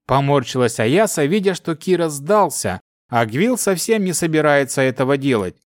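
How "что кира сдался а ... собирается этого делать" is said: plain reading voice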